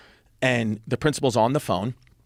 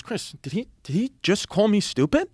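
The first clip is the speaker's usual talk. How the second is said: imitated dad, high and incredulous; offended disbelief; 8 semitones higher than usual. Dad to his son about Brother Rob, offended disbelief. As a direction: pitch climbs in stunned, offended questioning.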